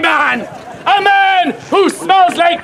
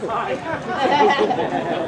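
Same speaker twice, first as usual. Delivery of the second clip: normal talking voice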